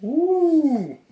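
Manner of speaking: surprised ooh. Real, not acted. Reacting to new microphone setup.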